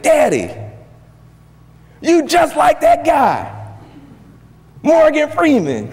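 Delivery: very high-pitched, drawn-out exclamations; excited son. Retold or imitated. Son telling Daddy about the movie, excited. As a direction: gush to dad in a high, amazed voice. Retelling excited words to his father.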